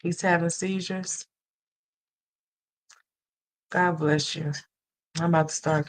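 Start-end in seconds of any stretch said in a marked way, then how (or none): none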